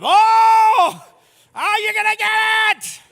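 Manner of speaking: very high, very loud hammed-up shriek; mock furious Jesus. Jesus to money changers, very high mock shriek. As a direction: shriek the threat, cartoon rage. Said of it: "He's like".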